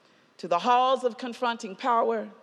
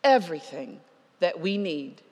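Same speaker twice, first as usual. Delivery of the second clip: earnest preaching; 3 semitones lower than usual. Acted. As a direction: speak blessing gently over the congregation. Preaching conclusion, soft and earnest.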